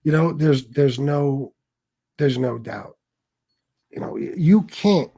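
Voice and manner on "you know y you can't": can't punched hard and higher; stress on can't. Shown as a demonstration. Telling obsessed viewers off, stressing can't. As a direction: hit the word can't. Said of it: "let me emphasize the word can't".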